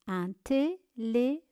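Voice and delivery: "téléphone" in four detached syllables. Shown as a demonstration. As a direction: space out every syllable of "téléphone". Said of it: "Première syllabe t". (« téléphone » découpé syllabe par syllabe)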